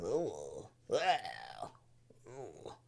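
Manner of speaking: mock pained groaning; low pained groans. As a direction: moan like a suffering teacher. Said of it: "that's the sound he looks like he's making".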